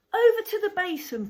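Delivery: high, fast, eager trainee. Acted. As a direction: chirp it out quickly. Trainee voiced in story.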